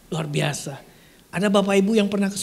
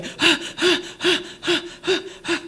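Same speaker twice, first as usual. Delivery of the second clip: napas terengah yang tinggi; terengah-engah; 10 semitones higher than usual. Acted. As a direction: tarik napas tersengal-sengal. Terengah setelah dari neraka. (terengah ketakutan)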